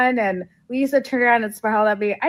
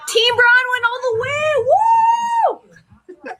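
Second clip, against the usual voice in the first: cheering; 12 semitones higher, much slower than usual. Real, not acted. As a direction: whoop it up for your side. Cheering while rewatching clip.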